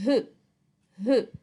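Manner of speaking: H said as a breath sound, not its name; letter sound of H. Shown as a demonstration. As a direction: sound out H as a puff of air. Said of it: "What's her sound?".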